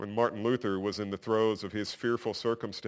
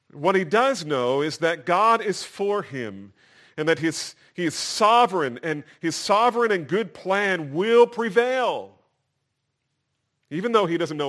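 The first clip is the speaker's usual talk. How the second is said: higher, louder, building conviction; emphatic preaching; 7 semitones higher, 7 dB louder than usual. Real, not acted. Preacher assures congregation God's plan will prevail, emphatic. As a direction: build to a confident pulpit peak.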